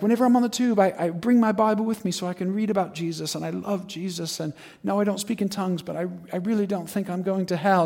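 imitated timid, earnest defence; meek protest. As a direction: plead gently that you are a true believer. Accused man pleads his faith.

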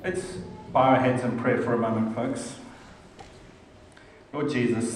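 low, soft prayerful voice. Acted. Pastor leading the congregation in prayer, soft and low. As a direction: pray quietly and reverently.

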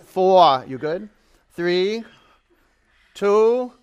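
loud, high countdown for the class. Real, not acted. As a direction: count them home. Coach counting down.

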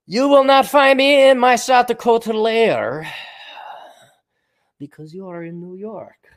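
slow, high, hammed-up foreign villain accent; a cartoon Bond villain gloating in a made-up accent. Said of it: "I'll talk with an accent".

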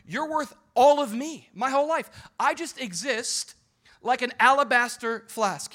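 high, loud impassioned preaching; full-force preaching. Acted. Pastor preaching at full force to his church. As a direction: preach it out to the congregation.

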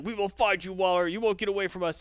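half-hearted hero's yell; retold yelling. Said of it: "green arrow starts yelling".

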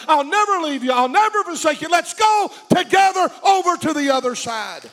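shouted divine promise, high and loud. Acted. God's promise shouted in sermon.

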